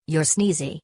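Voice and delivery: ordinary delivery, nothing marked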